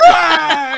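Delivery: high, loud cartoon scream of defeat; hammed-up ghost scream; spirit's shriek. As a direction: shriek as you're thrown out. Spirit shrieks being expelled.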